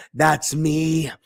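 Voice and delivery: ordinary talk, nothing marked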